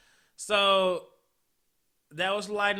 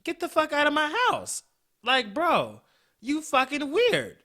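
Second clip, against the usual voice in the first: angry; 9 semitones higher, 5 dB louder than usual. Retold or imitated. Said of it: "And I'm like".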